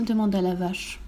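plain reading voice

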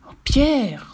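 put-on scandalised cry at a rude child; drawn-out indignant reproach. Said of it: "s'exclama la famille indignée".

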